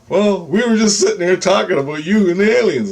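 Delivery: high, eager retold reply; lightly put-on excited friend's voice; animated buddy quote. Acting out his buddy's reply.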